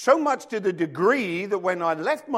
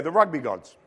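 ordinary delivery

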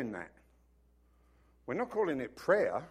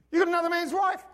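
God accusing; 13 semitones higher, 7 dB louder, faster than usual. Retold or imitated. God answering king.

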